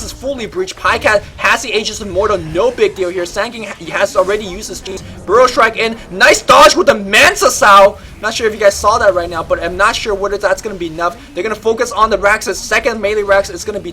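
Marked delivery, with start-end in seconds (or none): 5.2-8.0 loud, high, rapid fight call; live play-by-play. Acted. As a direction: call the teamfight at full hype. Live team fight commentary.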